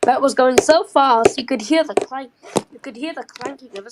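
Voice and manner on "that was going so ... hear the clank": loud, excited outburst; excited shout. Real, not acted. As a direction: yell it out, thrilled. Excited about fast train.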